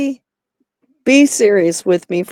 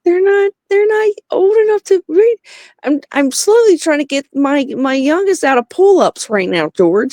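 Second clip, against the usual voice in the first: exasperated; 9 semitones higher than usual. Real, not acted. Exasperated mom explaining kids.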